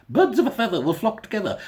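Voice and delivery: posh great-grandmother again, laughing. Retold or imitated. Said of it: "I don't know why she sounded posh then".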